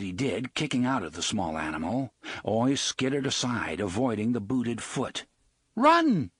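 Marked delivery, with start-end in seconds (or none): 5.8-6.3 high, loud sharp shout; boy shouting for his pet to flee. Acted. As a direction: yell it at the animal. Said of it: "Jake shouted".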